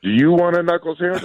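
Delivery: high kid-voice impression throwing a question back; imitating little daughter. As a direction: pipe it up like a cheeky little girl. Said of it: "And she goes".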